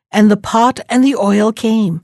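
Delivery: ordinary delivery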